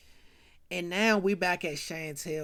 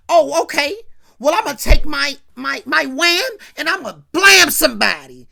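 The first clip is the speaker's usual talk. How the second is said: loud, higher mock-tough talk; cocky bluster; 5 semitones higher, 12 dB louder than usual. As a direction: puff up like a wannabe gangster. G mock-threatens with gun.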